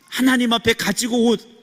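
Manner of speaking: ordinary talk, nothing marked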